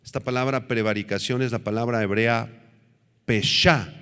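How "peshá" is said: peshá with the weight on its last syllable; stress on final A. Shown as a demonstration. Preacher teaching a Hebrew word to the congregation, stress on final A of peshá. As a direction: say peshá, leaning on the closing A. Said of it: "con acento en la A".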